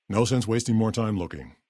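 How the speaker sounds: normal talking voice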